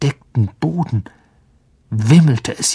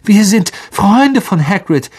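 higher, louder frightened call; 9 semitones higher, 5 dB louder than usual. Acted. Harry calls to the spiders, loud frightened plea. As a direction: shout it out to save your life. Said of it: "rief Harry".

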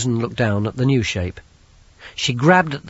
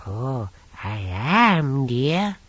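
put-on very old woman; 2 semitones higher, much slower than usual. Old lady voice, slow.

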